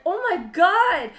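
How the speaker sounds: loud, high, drawn-out gasp of praise; acting out awestruck delight; imitated amazement. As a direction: imitate a teacher blown away by a drawing. Teacher amazed at her drawing.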